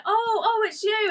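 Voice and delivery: high, mock-surprised realisation; mock sudden recognition. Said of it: "And then he was like".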